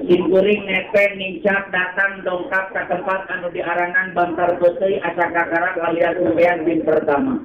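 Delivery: ordinary delivery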